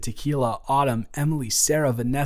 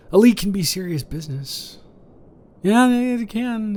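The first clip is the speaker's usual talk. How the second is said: mock gravity; 8 semitones higher, 4 dB louder than usual. Stressing the seriousness of a leak.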